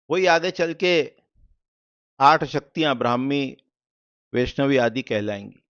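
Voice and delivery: ordinary talk, nothing marked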